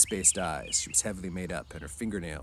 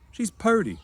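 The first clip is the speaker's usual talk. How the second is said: put-on much higher, louder, childlike protest; defensive Lenny; 14 semitones higher, 8 dB louder than usual. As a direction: stand up for her like a hurt child. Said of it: "said Lenny defensively".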